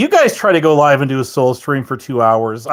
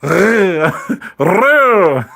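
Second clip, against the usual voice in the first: repeated dry-mouthed R trills; attempted trilled growl; 3 semitones higher than usual. Shown as a demonstration. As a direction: keep trying the rolled growl. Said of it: "my mouth is so dry I can't do it".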